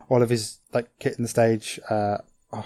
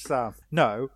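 usual speaking voice

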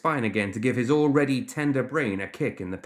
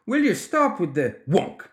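higher, exasperated plea cut off by a blow; protesting; 7 semitones higher than usual. A narrator's reading. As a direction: protest with the pitch jumping up. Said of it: "O'Duggan protested".

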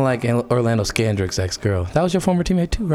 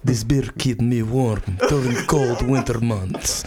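mock Russian accent. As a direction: boast about the beer in a put-on Russian accent. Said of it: "You keep working on that accent".

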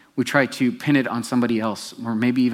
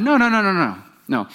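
serpent contradicting; 8 semitones higher, 4 dB louder than usual. Acted. Serpent voiced contradicting Eve.

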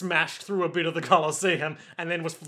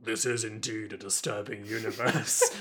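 lower, softer, grave dog voice; deep dog voice; 7 semitones lower, 6 dB quieter than usual. Retold or imitated. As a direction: speak like a solemn talking dog. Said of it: "The dog is like".

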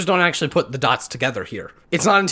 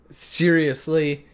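ordinary talk, nothing marked

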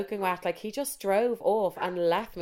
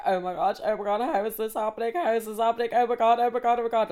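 melodramatic tearful babble, pitched up; mock bawling; 4 semitones higher than usual. Dumped woman talking to herself on a bench, melodramatic mock bawling. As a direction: imitate yourself crying hysterically, hammed up. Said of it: "bawling, crying".

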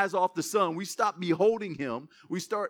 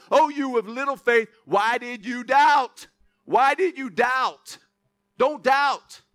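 projected, emphatic sermon reading; preaching scripture; 4 semitones higher, 5 dB louder than usual. Acted. Preacher gives Jesus' reassurance to the church, emphatic preaching. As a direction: proclaim each phrase to the congregation.

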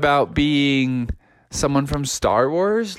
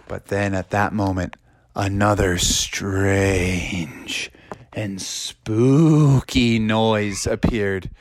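very slow, low storytelling; spooky suspense; 6 semitones lower, much slower than usual. A narrator's reading. Narrator announces a noise to listeners, slow and spooky. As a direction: draw each word out eerily, low.

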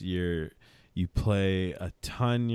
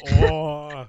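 loud, higher-pitched cringing groan; real sympathetic "oh" of discomfort; squeamish groan; 4 semitones higher, 8 dB louder than usual. Real, not acted. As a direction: wince aloud at someone else's pain. Squirms at the dislocation story.

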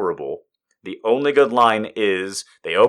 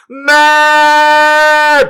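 much higher, much louder yell; wild shout; 11 dB louder than usual. Pretend. Mocking an early-2000s DJ with a wild yell. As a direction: belt it like a party cry.

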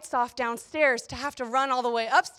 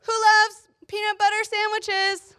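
very high, slowed playful dad voice; silly high sing-out to children; dad's little voice; 8 semitones higher than usual. Retold or imitated. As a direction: imitate dad teasing little kids in a high voice. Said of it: "he does that little voice".

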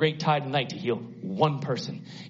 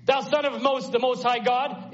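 high, pleading demon cry; a lightly voiced demon, high and pleading; 9 semitones higher than usual. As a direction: cry out like the tormented man. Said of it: "cried with a loud voice".

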